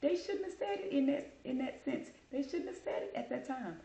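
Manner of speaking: high-pitched mock agreement; imitated amused listener. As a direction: mimic her agreeing emphatically, voice high. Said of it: "she was laughing like".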